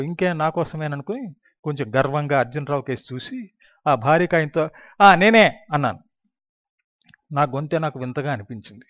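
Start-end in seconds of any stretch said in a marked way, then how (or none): 5.0-5.6 high, loud proud answer; loud, high, proud "ఆ, నేనే"; loud shout. Retold or imitated. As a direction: shout it out, pleased it's you. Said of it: "పెద్దగా అరిచానా అని".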